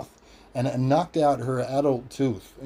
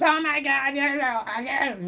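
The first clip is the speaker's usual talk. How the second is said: 1 exasperated mum; 12 semitones higher than usual. Retold or imitated. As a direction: shriek it like a fed-up mother.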